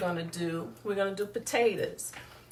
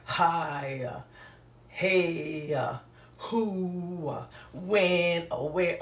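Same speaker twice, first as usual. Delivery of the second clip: exaggerated drawn-out greetings huffed at someone; mock talking with onion breath; onion-breath talker; much slower than usual. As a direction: puff out each word with a trailing "uh". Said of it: "don't need to be talking to people".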